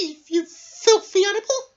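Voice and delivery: high, loud angry bee. Acted. Bees angrily order Norman out of school. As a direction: throw him out with a high shout.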